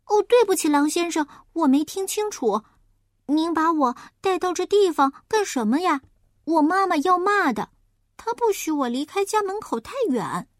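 a naive piglet apologizing; apologetic piglet. Acted. Piglet apologizes to the wolf, high and eager.

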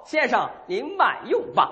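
high, drawn-out service voice; slow, high sing-song waiter courtesy; see the diner served, stretching the words; waiter's call. Pretend. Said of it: "全得大声的交流".

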